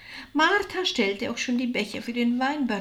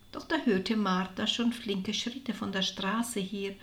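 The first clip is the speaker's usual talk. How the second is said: plain reading voice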